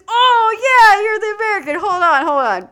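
high, booming mock welcome; loud hearty recognition. Dealer recognising the American, loud hammed-up voice. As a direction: call it out big and high, over the top.

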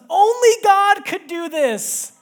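high, loud, slow overwhelmed cry; Only God could do this, cried out high, loud and slow; overwhelmed child. Retold or imitated. Daughter seeing her gift, crying out Only God could do this.

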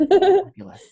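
high, loud, fabulous squeal; camp diva voice. Acted. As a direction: toss it off like a diva.

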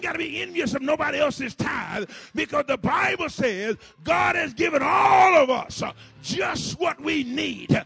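loud preacher's call; shouted sermon push; full-force preaching. Acted. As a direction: drive it home to the crowd.

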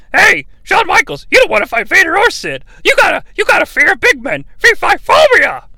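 high, squawking heckler; excitable ringside commentator. Acted. Commentator taunting Shawn Michaels about big men, high-pitched heckling. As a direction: needle him in a high, quick voice.

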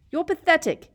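loud, high furious yell. A narrator's reading. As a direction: scream it in his face. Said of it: "Harry yelled".

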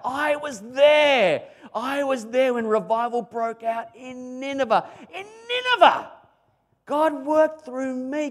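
high, loud, thrilled bragging; excited Jonah boasting. Pretend. Jonah bragging to his grandkids, excited and high. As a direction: crow about it like a proud old man. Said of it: "something to tell the grandkids about".

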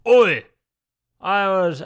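put-on a Newcastle accent that gives up fast; failed Newcastle accent. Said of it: "I can't do a Newcastle accent".

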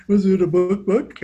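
laughing question. Real, not acted. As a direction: giggle through the question.

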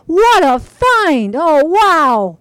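thrilled. Acted. Father thrilled by his find.

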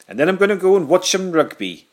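high, loud Welsh bloke voice; mock Welsh cop, proud and chirpy; Welsh accent. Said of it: "might have well have been called, like, Taff".

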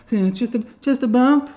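high, casual innocent voice; playing it cool. Telling the other driver it's nothing, high and breezy. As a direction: shrug off the bump lightly. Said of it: "I go".

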